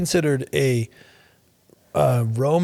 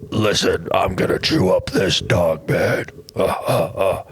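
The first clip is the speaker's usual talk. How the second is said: villain voice; 6 semitones lower, slower than usual. Retold or imitated. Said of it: "That's just a villain voice".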